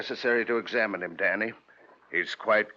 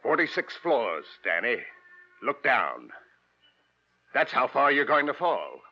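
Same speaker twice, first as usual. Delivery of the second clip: louder, slow gloating threat; gloating menace; 5 semitones higher, 7 dB louder than usual. Acted. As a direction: savour every word of the drop. Villain gloats at gunpoint.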